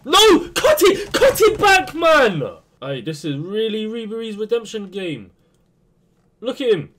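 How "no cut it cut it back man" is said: high, loud, urgent screams; desperate shout. Real, not acted. Gamer screaming at his winger to cut it back, urgent shout. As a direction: yell at the player to cut back now.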